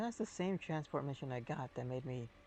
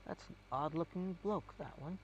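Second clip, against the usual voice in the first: rural English; 2 semitones higher, 5 dB quieter than usual. Retold or imitated. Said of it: "the virtual farmer English".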